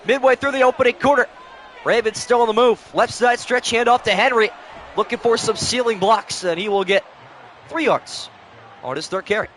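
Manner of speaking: broadcaster building up on third down. Acted. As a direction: sell the big catch like TV. Madden play-by-play call.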